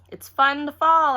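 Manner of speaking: fake-cheery little girl covering embarrassment; said real stupid. A narrator's reading. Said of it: "I said, real stupid".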